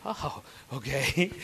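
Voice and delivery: slow, startled "oh, okay"; taken aback. Retold or imitated. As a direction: slowly back off, caught off guard. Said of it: "And I went".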